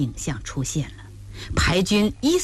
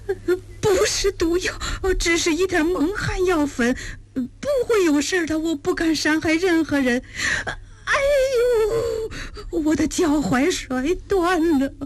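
high-pitched, whimpering, tearful begging; wailing plea; 11 semitones higher than usual. A narrator's reading. Injured thief pleading with his captors, wailing. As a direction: whine and sob in a thin high voice. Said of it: "昆山哀泣道".

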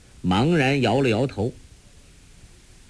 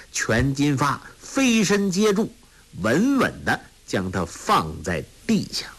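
ordinary delivery, nothing marked

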